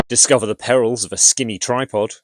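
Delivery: ordinary talk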